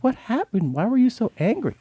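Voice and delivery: high, timid little plea; imitated meek mother. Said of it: "meek, and she was like".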